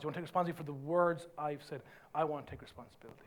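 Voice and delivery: low, soft, contrite admission; humble apology. Acted. Preacher voices humility admitting fault, low and contrite. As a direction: own the wrong quietly and humbly. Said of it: "Humility says".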